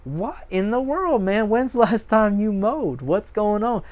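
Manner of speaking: high, incredulous neighbour asking; a lightly voiced stunned neighbour; shocked neighbour. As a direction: play the baffled visitor gawking at the yard. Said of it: "they go".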